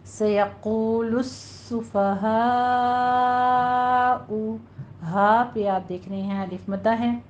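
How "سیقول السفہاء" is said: السفہاء with a hissing doubled seen; سیقول السفہاء slowly, the س of السفہاء whistled and held firm; slow recited demo of السفہاء; tajweed demo. Shown as a demonstration. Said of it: "سیٹی کی آواز بھی آنی چاہیے اور تشدید کو آپ نے سختی سے ادا کرنا".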